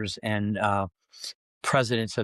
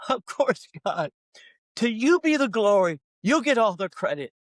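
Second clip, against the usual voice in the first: much higher, hammed-up holy voice; mock pious answer; 8 semitones higher than usual. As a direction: play the dutiful servant a bit too sweetly. Retelling his pious answer to God.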